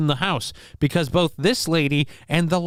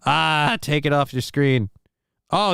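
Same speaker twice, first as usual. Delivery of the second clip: ordinary delivery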